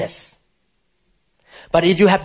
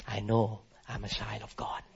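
usual speaking voice

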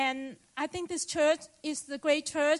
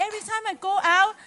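excited; 6 semitones higher, 6 dB louder, faster than usual. Real, not acted. Excited testimony about telling people.

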